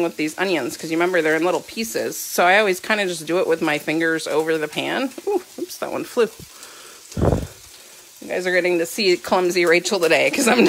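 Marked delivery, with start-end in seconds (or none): 5.3-5.8 quick high oops; startled by a slip; surprised. Real, not acted. Onion piece flew.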